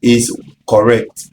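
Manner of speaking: normal talking voice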